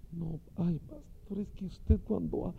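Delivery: soft, high breathy "ay, pastor"; mock seductive woman coaxing the pastor; flirty woman. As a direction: coo it softly with a rising sigh.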